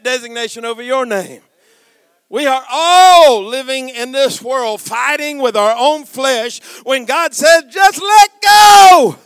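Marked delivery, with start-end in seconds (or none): 2.3-7.6 loud, high preacher's shout, building; full-force preaching. Real, not acted. Preacher telling the congregation God started the fight, full-force shout. 7.7-9.2 huge, high, slow shout; climactic command. Acted. God telling people fighting their flesh, roared command.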